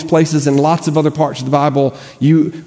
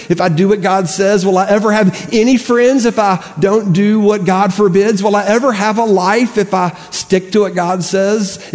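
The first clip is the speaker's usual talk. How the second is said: higher, pleading doubtful questions; tempted inner voice; 6 semitones higher than usual. Retold or imitated. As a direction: whine like a tempted heart.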